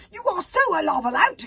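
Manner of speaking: high, loud retort; exclaimed accusation. A narrator's reading. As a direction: shout back insistently. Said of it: "exclaimed Kurt Stoic".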